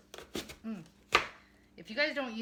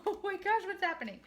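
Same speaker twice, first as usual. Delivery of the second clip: shoots up high, oh my gosh; sudden real alarm at the cutter; startled outburst; 10 semitones higher, 5 dB louder than usual. Real, not acted. Apple cutter suddenly fails.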